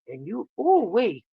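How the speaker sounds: ordinary delivery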